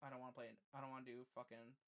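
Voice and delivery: very soft, low, worn-out mumble; dejected sign-off. Real, not acted. Streamer telling viewers he can't go on, dejected sign-off. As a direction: give up quietly, no fight left.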